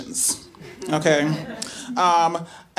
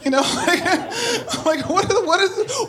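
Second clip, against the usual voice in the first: laughing incredulity; 11 semitones higher than usual. Retold or imitated.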